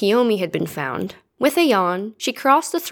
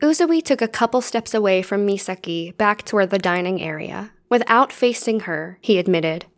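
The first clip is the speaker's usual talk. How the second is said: usual speaking voice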